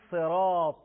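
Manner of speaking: صراط with thick, heavy ص and ط; full, heavy emphatic consonants in صراط; heavy articulation. Shown as a demonstration.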